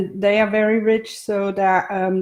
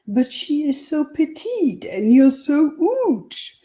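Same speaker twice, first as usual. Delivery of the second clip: put-on higher, slow French chef accent; 10 semitones higher, 3 dB louder than usual. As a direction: mimic the French cook's accent for a laugh. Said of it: "He imitated Henri's accent perfectly".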